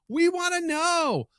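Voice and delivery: high, slow drawn-out call; high sing-song. Acted. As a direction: stretch it out playfully. Outro callout.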